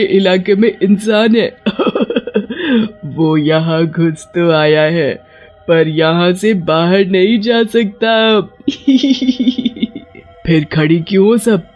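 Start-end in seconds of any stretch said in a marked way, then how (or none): 1.6-3.0 high, gloating witch laugh; witch cackle. Acted. Witches gloat over trapped humans. 8.7-10.0 high, wicked little cackle; witch giggle. Acted. Witch gloats over trapped prey.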